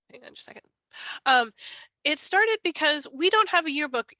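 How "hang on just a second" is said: whispered quick aside. Real, not acted. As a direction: breathe it off-mic. Quick aside during the talk.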